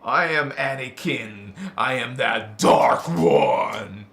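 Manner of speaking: low, slow, menacing Dark One voice; slow it down and growl like the dark lord; Darth Vader. Acted. Speaker plays Vader for the listener, deep slow menace. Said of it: "I'm Vader".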